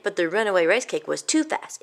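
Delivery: plain reading voice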